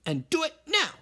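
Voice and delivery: high, firm order; ordering mama. Acted. Mama ordering her son to return the bones, high and firm. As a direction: command him to go right now. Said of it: "ordered Mama".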